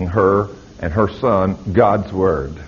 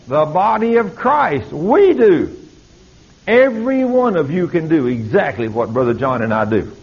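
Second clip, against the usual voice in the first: full-force preaching; 9 semitones higher than usual. Acted. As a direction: preach it at the congregation with fire.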